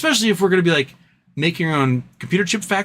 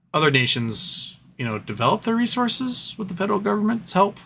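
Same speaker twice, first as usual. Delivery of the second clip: ordinary talk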